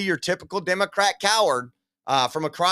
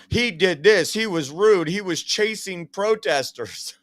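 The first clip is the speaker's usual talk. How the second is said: higher, mock-outraged accusation; put-on accusing voice, pitched up; mocking accusers; 4 semitones higher than usual. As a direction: mimic critics making their accusations. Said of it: "That's what they tried to say".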